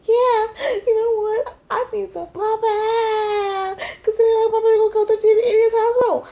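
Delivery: high, wobbly fake sob; hammed-up weepy agreement; mock crying. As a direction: whine it through mock tears. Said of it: "And I'ma be like".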